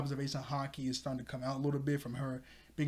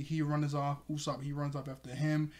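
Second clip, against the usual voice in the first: usual speaking voice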